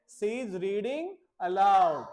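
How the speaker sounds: "She is reading aloud" said slowly, stress on "reading"; slow model sentence. Shown as a demonstration. Teacher modelling English for the class, slow. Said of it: "मैं आपको क्या बता रहा हूँ".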